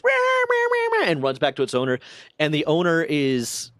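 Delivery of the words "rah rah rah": much higher little yapping barks; imitated dog yapping. The dachshund yapping as it runs back, high yaps. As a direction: yap like the dachshund running off. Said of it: "it goes".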